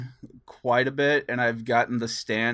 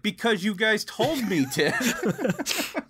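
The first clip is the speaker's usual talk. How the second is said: high, slow, laughing disbelief; mock-whiny laughing retort; laughing exasperation; 10 semitones higher, much slower than usual. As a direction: stretch it out through a laugh. Retells exasperated reply.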